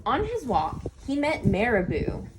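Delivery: ordinary delivery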